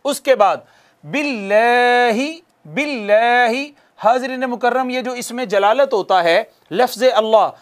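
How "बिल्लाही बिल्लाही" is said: बिल्लाही with a light, thin ल, drawn out; slow, light बिल्लाही; thin recited Allah. Shown as a demonstration. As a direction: recite बिल्लाही with lips pulled back. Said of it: "इसको एक अलिफ जितना खींचना है".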